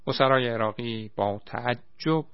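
ordinary delivery

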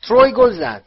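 loud, high goal call; Bagman shouting. A narrator's reading. Commentator announcing a goal to the crowd, shouting. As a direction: shout the goal to the whole stadium. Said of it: "بگمن فریاد زد".

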